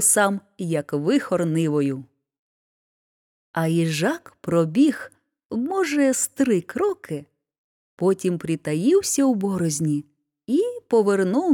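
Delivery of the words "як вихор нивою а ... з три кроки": ordinary delivery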